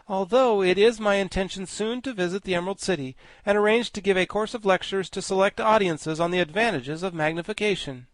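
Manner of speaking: high, loud, grandly learned insect; high pompous bug voice; pompous Woggle-Bug. Acted. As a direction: lecture like a vain scholar bug. Woggle-Bug states his plans.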